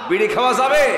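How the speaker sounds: high, loud challenge to a crowd; shouted question. Real, not acted. Preacher asking congregation about smoking, shouted challenge. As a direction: throw the question out at full volume.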